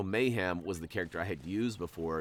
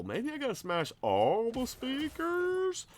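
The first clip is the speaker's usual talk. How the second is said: mock wondering; 14 semitones higher, slower than usual. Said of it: "I go back to the whole".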